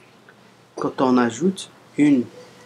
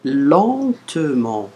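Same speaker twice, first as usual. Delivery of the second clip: said very slowly, syllable by syllable; drawn-out word; much slower than usual. Shown as a demonstration. Teacher to learners reading a word: very slow.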